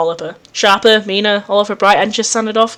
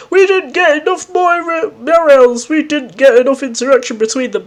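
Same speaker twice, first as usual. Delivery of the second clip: mock complaining fans; 5 semitones higher than usual. Player imitating people arguing online, mock whining complaint. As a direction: mimic fans moaning, dragging it out. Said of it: "They'll be like".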